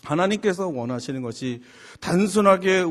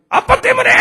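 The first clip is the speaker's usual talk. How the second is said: 찢어질 듯 높고 큰 고함; 있는 힘껏 내지르며 아빠를 탓하기; 맞고함치는 딸; 15 dB louder than usual. Retold or imitated. 아빠를 탓하며 맞받는 딸. (악쓰듯 맞받아치는 말투)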